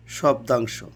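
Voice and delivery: normal talking voice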